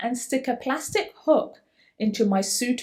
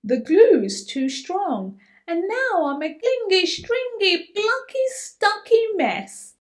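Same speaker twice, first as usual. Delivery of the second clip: sing-song refrain; 9 semitones higher than usual. A narrator's reading. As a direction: build the list up playfully. Refrain building up.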